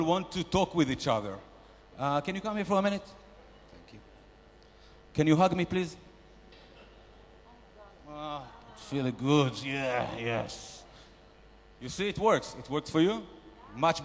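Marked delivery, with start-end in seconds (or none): 3.0-4.0 breathless whisper; whispered thanks. Acted. Shark whispers thanks to the woman hugging him. Hugged on stage. 8.1-10.8 contented sigh. Acted. Enjoying a hug on stage.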